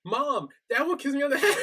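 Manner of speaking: high whiny mock wail, laughing; mock screaming. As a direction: wail for mom like a drunk kid. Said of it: "he starts, like, screaming".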